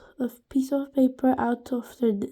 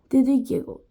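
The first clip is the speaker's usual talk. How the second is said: ordinary delivery, nothing marked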